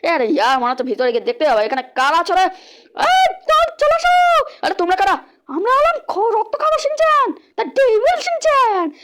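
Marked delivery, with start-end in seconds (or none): 3.0-8.9 much higher, menacing cartoon demon boasting; squeaky evil kid announcing himself slowly; devil Shinchan voice. Evil gang boss greets the player, high menacing voice.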